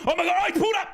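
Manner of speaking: high, panicked live reaction; alarmed outcry. Real, not acted. Commentator seeing the striker pull up, alarmed high cry.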